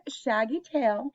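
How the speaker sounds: usual speaking voice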